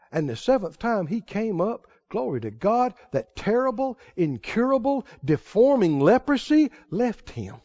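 usual speaking voice

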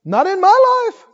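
playfully shocked rejection of doom talk; startled refusal. Retold or imitated. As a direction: brush off bad news with a laughing shocked no. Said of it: "You'd say".

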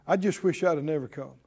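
low, slightly hurried grumble of regret; mock complaint. Preacher imitating negative talk to the congregation, low mock complaint. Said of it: "Have you ever heard this kind of talk".

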